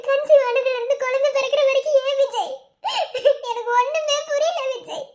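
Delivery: distraught plea broken by sobs; crying hard. Acted. Wife asking her husband why motherhood was denied her, crying hard. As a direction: plead tearfully, catching sobs between phrases. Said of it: "இப்ப ஏன் இவ்வளவு அழுதுட்டு இருக்க".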